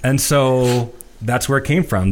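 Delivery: normal talking voice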